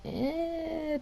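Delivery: high, soft, drawn-out squeak of doubt; doubtful "eh". Real, not acted. Storyteller on how the hotel room looked, high doubtful noise.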